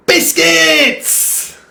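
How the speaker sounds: put-on high, loud child's yell; excited mock shout. Mum imitates her boy answering about dinner, high shout. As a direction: shout it way up high, drawing out the word. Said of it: "and he'd shout".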